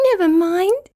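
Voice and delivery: high, tinkly and cheerful; happy butterfly. A narrator's reading. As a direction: chirp it out happily. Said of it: "said Butterfly happily".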